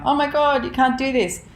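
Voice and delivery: high-pitched tearful mock wail; imitating her crying, pitch way up; retold bawling. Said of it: "she was, like, bawling".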